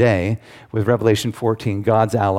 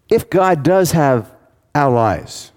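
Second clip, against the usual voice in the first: "If God does have", slow and higher, then a pause before "allies"; dramatic pause before allies; 3 semitones higher, 3 dB louder, slower than usual. Shown as a demonstration. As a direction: hold back, then drop "allies" after a beat. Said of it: "you notice how I'm doing this".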